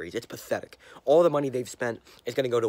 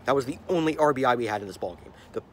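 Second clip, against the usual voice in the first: ordinary talk, nothing marked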